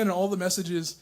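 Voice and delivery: usual speaking voice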